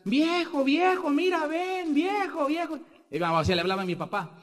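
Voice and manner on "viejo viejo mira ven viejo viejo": much higher calling to her husband; mother calling out. Retold or imitated. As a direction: call for the old man, stretched and high. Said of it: "empieza a gritar". (madre llamando al viejo, mucho más aguda)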